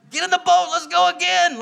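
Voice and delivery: loud, high, excited call; eager. Retold or imitated. Peter urging the crew back out, loud and excited. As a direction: hype up the crew to go again.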